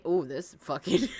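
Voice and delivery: usual speaking voice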